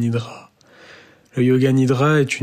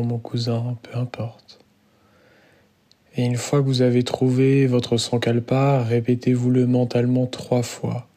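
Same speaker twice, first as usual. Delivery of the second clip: guided meditation. Real, not acted. Yoga teacher guides students lying down into Yoga Nidra, calm guided meditation.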